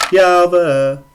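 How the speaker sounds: loud, high triumphant shout; excited call. Real, not acted. Calling the character's name out.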